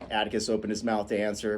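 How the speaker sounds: ordinary delivery, nothing marked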